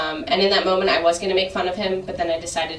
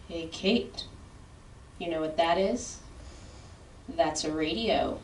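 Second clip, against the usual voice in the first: slow, softer dad voice, lightly done; lightly voiced dad, explaining slowly; dad-splaining; 2 semitones lower, 8 dB quieter, slower than usual. Dad pauses the movie to explain to his daughter, lightly voiced dad-splaining. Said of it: "my dad does this thing".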